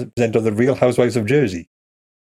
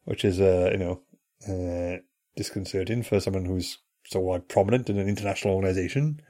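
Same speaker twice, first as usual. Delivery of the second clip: hushed aside; 4 semitones lower, 9 dB quieter than usual. Acted. Mock-hushed aside about suspicious man.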